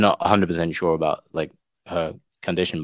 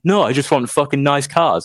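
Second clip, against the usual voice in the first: mock reply; 8 semitones higher, 4 dB louder than usual.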